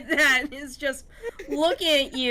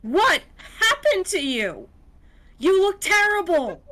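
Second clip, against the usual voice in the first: raised, incredulous angry outburst; angry, higher-pitched scolding; fuming; 6 semitones higher, 3 dB louder than usual. Acted. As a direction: play the fuming husband, pitched up. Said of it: "his husband looks to be fuming".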